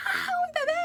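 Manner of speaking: chillido agudo y fuerte de emoción; alegría revivida. Retold or imitated. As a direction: revivir el grito feliz por el bebé. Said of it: "Yo estaba contenta porque dije". (grito agudo de alegría)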